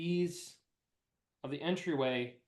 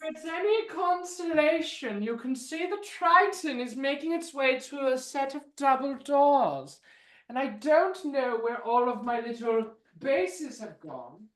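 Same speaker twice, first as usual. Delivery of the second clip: high, hammed-up villain accent; high theatrical villain voice; 7 semitones higher than usual. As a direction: play a bad-accented villain musing. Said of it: "begins to speak in a terrible accent".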